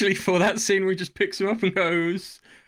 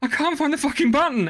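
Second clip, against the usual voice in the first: much higher, louder frantic voice; frantic Khan; 10 semitones higher, 4 dB louder than usual. Retold or imitated. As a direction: panic as you hunt for the button. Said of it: "And Khan's like".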